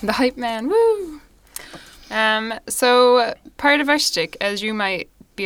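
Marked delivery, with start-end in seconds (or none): 0.7-1.2 cheering woo. Real, not acted. Hype cheer.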